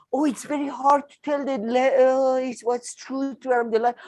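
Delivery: mocking. Mocking the guest's words.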